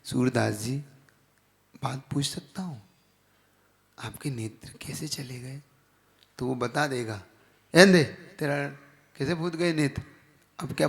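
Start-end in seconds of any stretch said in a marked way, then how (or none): 0.0-5.6 soft, low, careful question; gentle, respectful asking. Shown as a demonstration. Asking a blind man tactfully about his eyes, gentle and soft. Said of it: "और धीरे से". 7.7-10.0 rude, blunt asking. Shown as a demonstration. Preacher showing the wrong way to ask a blind man, rude. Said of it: "और धीरे से".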